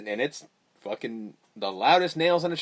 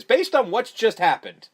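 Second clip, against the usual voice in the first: ordinary talk, nothing marked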